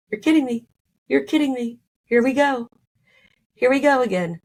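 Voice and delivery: high, fed-up retelling; here-it-comes-again exasperation; exasperated disbelief. Retold or imitated. Waiting alone, no word from partner.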